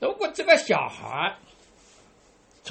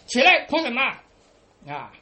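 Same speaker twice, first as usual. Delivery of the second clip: put-on louder, higher command to a child; brusque parental order; 3 semitones higher, 5 dB louder than usual. Father tells his fallen child to get up, lightly acted brusque order.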